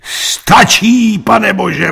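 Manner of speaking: very high, loud outcry; exasperated shout. A narrator's reading. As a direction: call it out loudly as the jury chairman. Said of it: "volal předseda".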